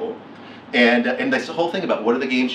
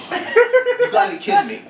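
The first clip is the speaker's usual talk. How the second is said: high, loud, laughing squeal of disbelief; laughing, high-pitched you-gotta-be-kidding-me; stunned disbelief; 4 dB louder than usual. Retold or imitated. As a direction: shriek it like you can't believe your luck. Retelling own shock at free ticket.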